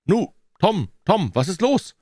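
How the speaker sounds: put-on high, alarmed boy's questioning; high-pitched worry; anxious. A narrator's reading. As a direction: worriedly try to rouse a friend. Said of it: "starrte ihm voll Angst ins Gesicht".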